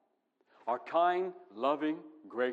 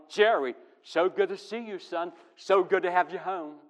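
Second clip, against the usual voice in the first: higher, beaming greeting to a returning son; glad, affectionate fatherly hello; joyful father's welcome; 5 semitones higher, 5 dB louder than usual. Retold or imitated. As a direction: welcome him home like a delighted dad.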